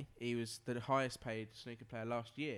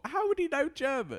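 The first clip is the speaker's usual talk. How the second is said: high, louder incredulous question; 13 semitones higher, 7 dB louder than usual. Real, not acted. Host challenging co-host's claim, incredulous. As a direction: throw it back in disbelief, pitched right up.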